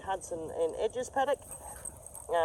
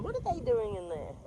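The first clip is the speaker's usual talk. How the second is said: softer, higher nosy voice; curious horses; 5 semitones higher, 5 dB quieter than usual. Acted. Owner voices horses eyeing newcomers, soft and curious. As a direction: wonder aloud as the onlooking animals. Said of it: "Arthur, Mick and Fred are going".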